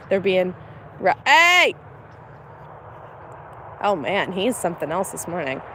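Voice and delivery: usual speaking voice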